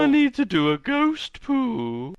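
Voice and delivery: high, slow ghostly voice. Acted. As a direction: play a childlike little ghost. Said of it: "I like his ghost voice".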